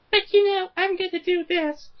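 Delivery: very high, strained character voice; a failed attempt at a villain's voice; squeaky put-on voice. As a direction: squeeze the voice way up into a silly falsetto. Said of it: "I couldn't do that voice".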